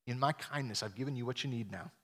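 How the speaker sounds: soft, low gentle urging; quiet, reassuring appeal; kind invitation. Acted. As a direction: invite him tenderly, without demanding. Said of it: "It's a kind invitation to say".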